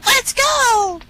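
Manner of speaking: high quacking duck voice; excited cartoon duck; Ducky Lucky. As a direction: quack it out high, stretching the end. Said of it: "quacked Ducky Lucky".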